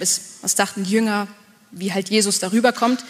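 ordinary delivery

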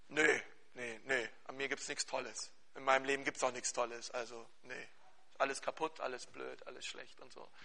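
low, soft, listless mock-sulk; gloomy mumble. Retold gloomy answer of a teen.

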